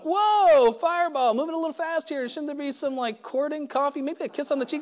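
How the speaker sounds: high, loud comic protest; mock alarm. Newcomer to pushy would-be sponsor, mock alarm. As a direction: burst out high on "whoa", playfully taken aback.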